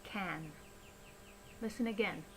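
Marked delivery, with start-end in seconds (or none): 0.0-0.5 "Can" with a full short-a vowel; clear, full-vowel "Can"; pronounced fully. Shown as a demonstration. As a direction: say "Can" alone, unreduced, never "kun". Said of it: "then it is pronounced fully".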